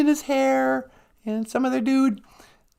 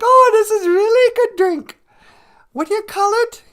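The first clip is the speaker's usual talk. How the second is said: high, loud wondering voice; a hammed-up voice tasting something new; delighted hammed-up voice over a first cup of coffee; delighted tribesman; 8 semitones higher, 6 dB louder than usual.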